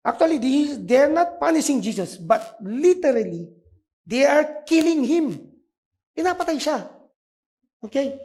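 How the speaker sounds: high, loud preaching at full force; fervent preaching. Acted. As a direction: preach it hard, the soldiers are killing him. Preacher retells Pastor Richard passionately. (masigasig na pangangaral, malakas at mataas)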